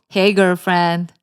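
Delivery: put-on slow, level greeting in a boyfriend's role; boyfriend on the phone. Ryle greets his girlfriend on a call, acted boyfriend voice.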